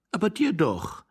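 high, insistent correction; exasperated young wife. Acted. Young wife setting her forgetful husband straight, high and insistent.

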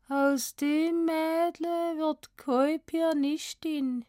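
put-on high, very slow Swabian girl, dazed; Swabian murmur. A narrator's reading. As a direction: murmur it dreamily in Swabian dialect. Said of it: "murmelte Valentina". (benommen auf Schwäbisch gemurmelt)

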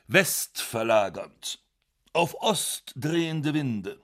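ordinary delivery

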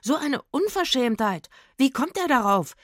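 high, loud outrage; affronted shouted protest; indignant. A narrator's reading. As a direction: call it out, deeply offended. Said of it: "fragte Rufus empört".

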